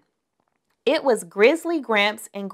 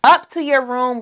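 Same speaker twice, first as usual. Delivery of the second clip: louder, higher angry roar; Papa bellows the order; 4 semitones higher, 6 dB louder than usual. A narrator's reading. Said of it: "roared Papa".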